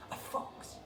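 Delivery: breathless scared little cry; scared mouse cry; frightened squeal. A narrator's reading. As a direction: gasp it out in fright. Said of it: "squealed the frightened little mice".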